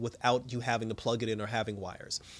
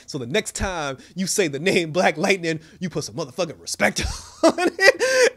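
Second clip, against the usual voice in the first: toast speech; 4 semitones higher, 7 dB louder than usual. Retold or imitated. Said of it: "he's like".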